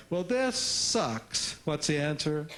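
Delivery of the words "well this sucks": put-on sulky kid. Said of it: "she says something like".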